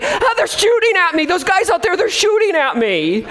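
high, mock-panicked complaining soldier; hammed-up grumbling, half laughing; whiny soldier. Soldier runs into the officer's tent complaining, high and whiny. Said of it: "started complaining".

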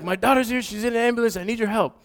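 high-pitched urgent pleading; retold frantic parent, words tumbling out. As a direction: plead for help in an acted panic. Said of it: "I'm all out of breath".

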